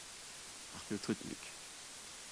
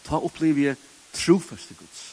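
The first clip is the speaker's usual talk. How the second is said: ordinary delivery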